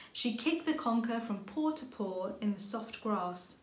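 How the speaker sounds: ordinary delivery, nothing marked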